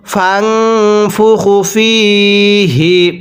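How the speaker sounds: slow chanted tajweed recitation; slow melodic Quran chant. Shown as a demonstration. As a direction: recite it long and measured.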